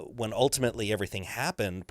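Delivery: ordinary delivery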